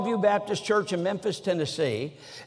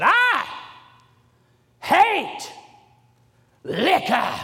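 put-on Southern accent; 8 semitones higher, much slower than usual. Old preacher suddenly stops his message, Southern accent declaiming. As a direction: declaim each word alone in an exaggerated Southern accent. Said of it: "trying to use my su-, best Southern accent".

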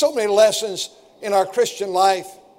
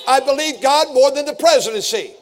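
raised, building pulpit cry; rising toward the climax, driving each "I believe"; impassioned preacher declaring his faith; preaching at full force; 5 semitones higher, 3 dB louder than usual. Acted. Closing crescendo of the sermon.

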